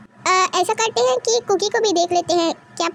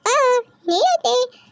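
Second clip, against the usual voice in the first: slow cooing hush; soothing a baby; 4 semitones higher, much slower than usual. Acted. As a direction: rock the crying baby gently.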